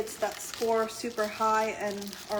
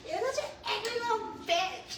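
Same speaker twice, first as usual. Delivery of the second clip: much higher, squeaky mimicry; mock squeaky voice; 12 semitones higher than usual. Imitating the viewer's jab in a much higher squeaky voice. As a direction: squeak the insult like a cartoon. Mocking a chat comment.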